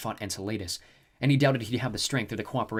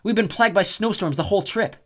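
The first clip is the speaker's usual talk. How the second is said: higher, louder accusation; accusing Piper; 10 semitones higher, 8 dB louder than usual. A narrator's reading. Piper confronting the snow goddess, loud accusation. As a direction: call her out sharply.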